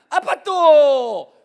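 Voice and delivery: put-on drawn-out, loud childish plea; imitating a little son. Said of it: "아들이 하는 말".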